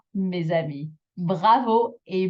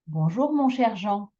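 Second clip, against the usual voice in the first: bonjour mon cher, then a long JEAN; extra final intonation; 3 semitones higher than usual. Shown as a demonstration. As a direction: linger on Jean at the end of the group. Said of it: "intonation on that last bit".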